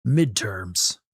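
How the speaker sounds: terse soldier reply; Solid Snake. Pretend. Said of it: "congratulate me and Solid Snake's voice".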